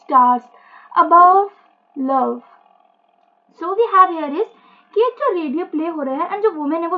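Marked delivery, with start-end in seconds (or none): 0.0-2.4 slow, halting text reading, lower in pitch; monologue reading. Acted. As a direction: read it word by word with long pauses. Reads the monologue aloud. (धीमे, रुक-रुककर पाठ पढ़ना)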